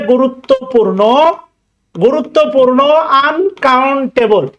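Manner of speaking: Uncountable drawn out syllable by syllable; slow dictation. Shown as a demonstration. Teacher to students taking notes, slowly dictating. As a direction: dictate the word slowly so they can write it. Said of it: "আমি আস্তে আস্তে বলতেছি".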